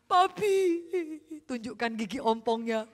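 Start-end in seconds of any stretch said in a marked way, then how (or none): 0.0-0.6 put-on higher, louder sugary little girl; cute daughter. Daughter charming her father, cute sweet Papi. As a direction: melt daddy's heart. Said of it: "mengintimidasi papanya dengan".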